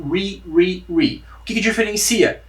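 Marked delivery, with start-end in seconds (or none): 0.1-1.2 re with an English r; English re syllable. Shown as a demonstration. Teacher drilling the English re sound. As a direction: repeat re evenly. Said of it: "Então, fale re".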